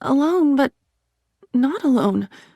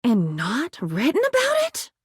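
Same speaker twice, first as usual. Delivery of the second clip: normal talking voice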